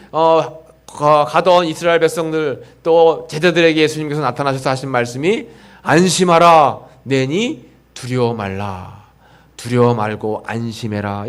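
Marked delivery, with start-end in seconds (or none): 5.8-11.3 reassuring Jesus. Acted. Jesus reassures the frightened disciples, calm and slow. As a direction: settle their fear gently. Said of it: "예수님이 우리에게 말씀하시는".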